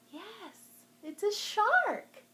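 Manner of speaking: loud, high and slow announcing; excited reveal. Real, not acted. Librarian revealing the shark to kids, excited. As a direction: stretch out the big reveal.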